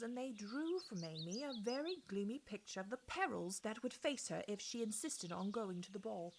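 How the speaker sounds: ordinary delivery, nothing marked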